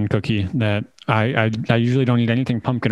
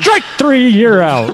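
loud, high umpire call; umpire calling strikes; 10 semitones higher, 7 dB louder, slower than usual. Pretend. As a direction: bellow it like an umpire. Umpire call in baseball bit.

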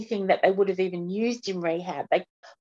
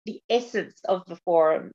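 ordinary talk

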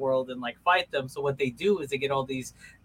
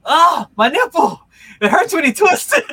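mock nipple wail; 13 semitones higher, 13 dB louder than usual. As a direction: wail it like a silly schoolboy prank. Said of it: "and be like".

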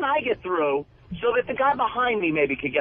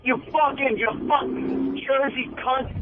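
angry yelling; 7 semitones higher than usual. Real, not acted. Yelling at a driver in traffic.

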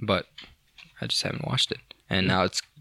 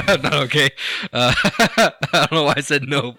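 laughing; 4 semitones higher, 5 dB louder than usual. Real, not acted. Laughing at own odd answer.

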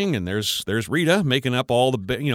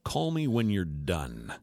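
softer, slow and dry; curt dismissal; 5 semitones lower, 8 dB quieter, slower than usual. Retold or imitated. Grandpa handing off the kid at the mall, slow and soft. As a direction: brush him off slowly.

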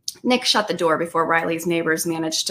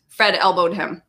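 plain reading voice

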